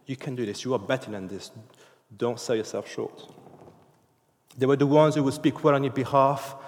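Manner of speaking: ordinary talk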